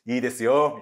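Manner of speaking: loud, playful yes; game, willing agreement. Acted. Kaori lets her friend stay another night, playfully willing. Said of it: "ノリよく".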